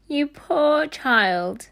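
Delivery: slow, high, pitying sigh; a housekeeper pitying a dirty orphan, drawn out slowly; slow, high tender sigh. A narrator's reading. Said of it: "sighed Missus Bedwin".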